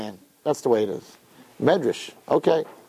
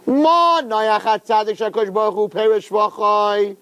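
recited Medrash; 6 semitones higher than usual. Acted. Rabbi quoting the Medrash on Noach, raised singsong. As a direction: sustain it high, like intoning a text.

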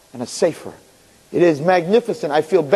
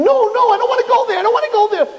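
much higher, louder, terrified pleading; panicked screaming; 4 dB louder than usual. Acted. Chaim screams to Hashem, terrified of Gehenna. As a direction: cry out in terror for help. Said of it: "he starts screaming".

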